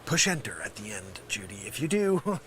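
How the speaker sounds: low, hushed, seething instruction; barely contained exasperation, very soft; suddenly restrained. Acted. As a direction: drop to a quiet, low warning. Drops after the shouted warning.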